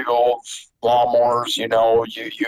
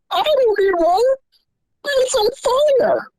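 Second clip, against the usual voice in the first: dad exasperated. Retold or imitated. Dad retorts to mom.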